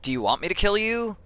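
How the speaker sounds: high, disbelieving question; incredulous. Real, not acted. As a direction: ask it in sheer disbelief. Incredulous question to game character.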